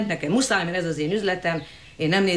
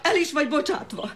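gloating laugh; 6 semitones higher than usual. Acted. Owner fires Lilium, gloating.

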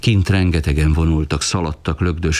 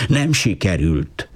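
plain reading voice

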